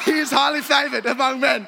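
loud, high proclaiming shout; mock preacher shout. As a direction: declare it like a revival preacher.

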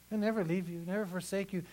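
usual speaking voice

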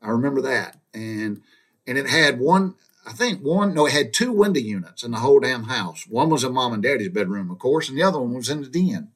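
ordinary delivery

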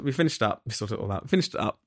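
usual speaking voice

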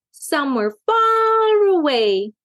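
high, drawn-out wistful wish; sighed longing. A narrator's reading. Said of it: "sighed Daisy".

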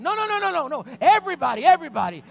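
very high, loud rapid-fire yelling; freaking out. Retold or imitated. Husband overruling wife about gas, freaking out. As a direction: shout down all objections. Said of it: "I lost it".

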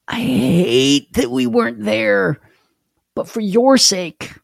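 lightly voiced Jesus grieving yet purposeful; slow high voice of Christ speaking to the mourners; voicing Christ. Voicing Christ at Lazarus' death.

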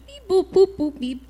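high beeps and boops, dropping off at the end; imitated phone beeps. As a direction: mimic someone tapping away on a phone. Mimics someone absorbed in their phone.

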